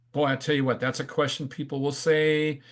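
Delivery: ordinary talk, nothing marked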